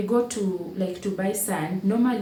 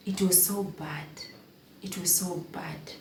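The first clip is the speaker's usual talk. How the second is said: hushed whisper, barely voiced; 6 dB quieter than usual. Real, not acted. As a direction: breathe it out like a confession. Recalling the worst nights.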